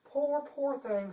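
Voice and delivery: high, prim and indignant; a dainty lady playing at outrage; fussy chancellor. Chancellor protesting to the detective, high and prim. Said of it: "said Funderburk".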